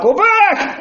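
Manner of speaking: high, loud desperate cry; piercing scream to warn a buddy. Retold or imitated. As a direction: yell it up from under the water. Drowning boy warns friend.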